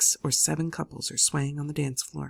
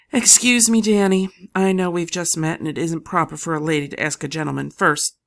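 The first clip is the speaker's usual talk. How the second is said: nervous polite ask; 2 semitones higher, 8 dB louder than usual. A narrator's reading. Lily nervously asks a man.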